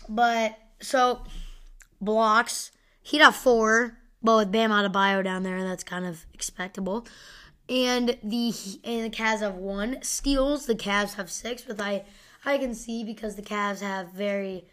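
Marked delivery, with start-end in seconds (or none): none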